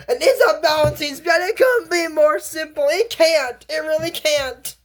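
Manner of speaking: can't believe it failed; exasperated outburst. Real, not acted. Exasperated commentary on the spell.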